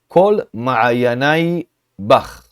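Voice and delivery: ma'ayanai with a clear final ai, bach with a hard b; very slow Hebrew reading. Shown as a demonstration. Teacher reading the verse for students, very slow. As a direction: drag out each syllable. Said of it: "Aqui a gente pronuncia a letra Yud".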